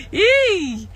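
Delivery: high squealed yay through laughter; giddy squeal; mock cheer. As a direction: squeal it while still laughing.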